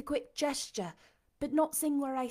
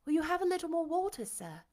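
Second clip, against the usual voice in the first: higher, quick polite offer; attentive young woman; 7 semitones higher, faster than usual. A narrator's reading. Jane offering her blind master water, politely.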